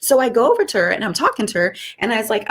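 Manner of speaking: ordinary talk, nothing marked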